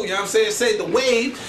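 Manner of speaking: ordinary delivery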